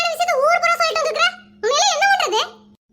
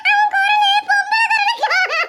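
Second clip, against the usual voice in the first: high, slow, laughing sing-song; giggling as she spills the joke; laughing through words; 8 semitones higher than usual. Real, not acted. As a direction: squeal it out between laughs. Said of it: "இந்த சிரிப்பு சிரிக்கிற".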